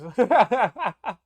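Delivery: a burst of high, loud laughter; real amused laugh. Real, not acted. Host laughs at the Vine joke.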